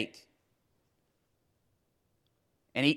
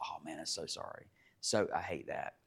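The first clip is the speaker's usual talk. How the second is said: soft sympathy; 11 semitones lower, 17 dB quieter than usual. Retold or imitated. Sympathy for lost child.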